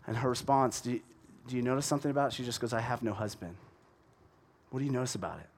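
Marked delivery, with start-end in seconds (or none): 2.7-3.5 quiet, blunt "I have no husband"; a woman giving a short, closed-off answer; curt reply. Shown as a demonstration. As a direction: shut the topic down in four words. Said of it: "It's short. It's four words".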